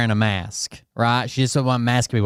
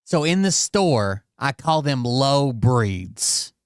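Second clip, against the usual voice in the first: drawn-out disbelieving retelling; slow emphatic repeat; slow deliberate recap; much slower than usual. Real, not acted. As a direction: stretch each word out, slowly. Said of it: "slow dramatic recap".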